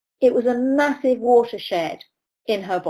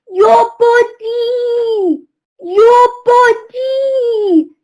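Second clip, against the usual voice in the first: put-on high, very slow, sing-song Belgian-accented wail; strange Belgian accent; 14 semitones higher, 7 dB louder, much slower than usual. Belgian coach calling to riders from his viewing gallery, slow high Belgian-accented wail. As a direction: stretch each word out like an exasperated Belgian coach. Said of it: "in his strange Belgian accent".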